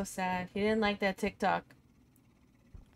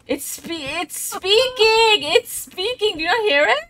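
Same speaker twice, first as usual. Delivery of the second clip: 1 frightened; 8 semitones higher, 9 dB louder than usual. Real, not acted. Ghost talking through spirit box.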